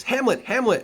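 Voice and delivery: mother shrieking at her son; queen's cry. Acted. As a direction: cry out to stop your son. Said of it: "the queen cries".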